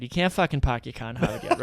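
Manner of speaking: amused Boston accent, Rs dropped. Shown as a demonstration. Host explains parking at Harvard, Boston accent. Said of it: "a word on the Boston accent".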